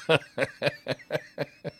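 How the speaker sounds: chuckling at his own story; real laughter. Real, not acted. Husband recalling his wife's outrage, real laughter. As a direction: crack up at the memory. Cracks up after retelling her reaction.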